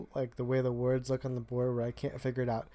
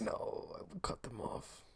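slow menacing whisper; 3 semitones higher, 13 dB quieter, slower than usual. Real, not acted. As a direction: hiss it as a quiet threat. Mock threat aside.

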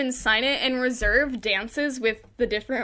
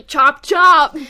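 punched louder and higher, breaking into laughter; loud mock command; 3 semitones higher, 14 dB louder than usual. Host joking about Eiffel cutting toes, loud mock command. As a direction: belt it out as a jokey quote.

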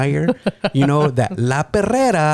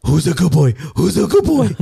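put-on higher, louder baby-talk to a puppy; talking to a dog; 6 semitones higher, 4 dB louder than usual. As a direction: coo like praising a dog. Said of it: "feel her demeanor change".

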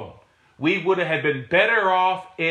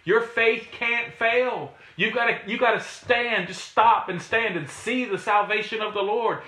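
ordinary talk, nothing marked

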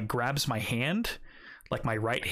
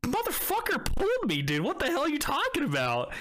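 outraged protest; 14 semitones higher than usual. Retold or imitated. Retelling his outraged protest.

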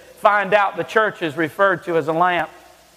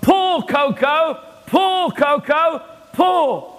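slow, much higher put-on call to a horse; mock yell; 9 semitones higher, much slower than usual. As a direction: drag out the farmer's call, pitched way up. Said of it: "he yells".

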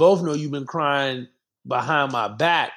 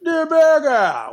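goofy drawn-out word; 11 semitones higher, 6 dB louder, slower than usual. Pretend. Joking mispronunciation of Twitter.

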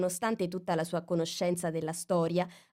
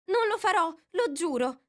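Alice voice; 11 semitones higher, 3 dB louder than usual. A narrator's reading. Alice puzzles over the mouse. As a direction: wonder to yourself.

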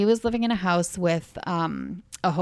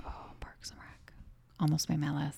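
whispering; 11 dB quieter than usual. Real, not acted. Whispered aside about a list.

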